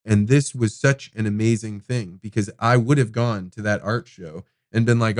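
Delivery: ordinary talk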